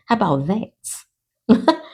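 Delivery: drawn-out New Orleans accent, very slow; slow Nola accent. Shown as a demonstration. Reader repeating herself slowly in her Nola accent. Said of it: "in my old Nola accent".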